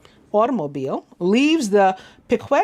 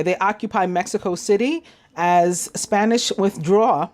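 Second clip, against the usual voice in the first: ordinary talk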